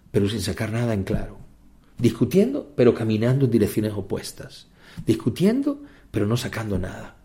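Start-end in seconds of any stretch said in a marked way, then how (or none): 2.0-2.7 «Discutiendo» lanzado muy agudo; emphatic rise. Acted. Rhetorical repetition in sermon. 5.0-5.8 «Discutiendo» aún más agudo; emphatic rise. Acted. Rhetorical repetition in sermon.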